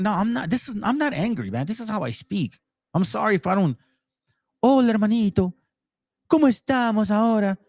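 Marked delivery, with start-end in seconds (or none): 4.6-7.6 mock-friendly greeting. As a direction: put on an overly friendly voice. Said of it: "I don't talk like that".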